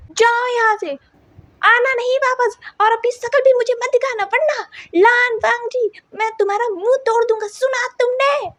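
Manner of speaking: high, loud furious yelling; angry shout. A narrator's reading. Wing shouting across the cafeteria, high and loud. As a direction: yell at him to get lost. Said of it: "wing ने इतने जोर से चिल्लाकर कहा था".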